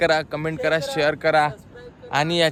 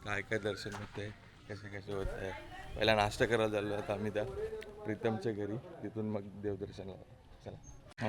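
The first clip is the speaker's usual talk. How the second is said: whispering; 6 semitones lower, 15 dB quieter than usual. Real, not acted. Early morning hushed vlogging.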